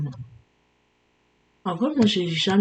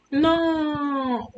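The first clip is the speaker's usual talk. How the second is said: surprised shout; 9 semitones higher than usual. Real, not acted. Surprised at game item.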